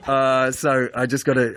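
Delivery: ordinary talk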